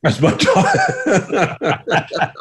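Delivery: laughing through the words, voice squeezed high; a real laugh bubbling up as he owns the dog. Real, not acted. Admitting the barking dog is his, laughing.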